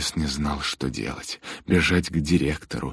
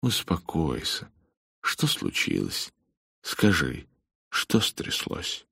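gentle; slower than usual. Acted. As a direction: coax it out of him. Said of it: "мягко сказал он".

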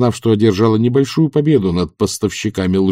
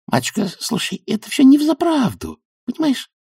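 very high, pleading voice; high-pitched, nervous appeasing; anxious young witch. A narrator's reading. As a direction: plead in a small high voice to calm her. Magrat anxiously calms Granny in story.

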